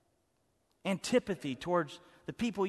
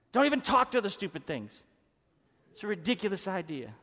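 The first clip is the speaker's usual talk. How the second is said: usual speaking voice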